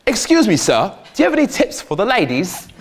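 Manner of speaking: high, polite put-on voice; Peter. Passenger asking taxi guy for tips, put-on polite voice. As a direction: start high and courteous, like an eager questioner. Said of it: "older sister of mine named Peter".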